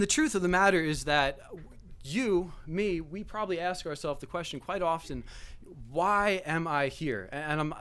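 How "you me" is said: usual speaking voice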